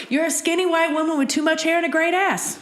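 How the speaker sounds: hammed-up honest friend; imitating Joe. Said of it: "and he is like".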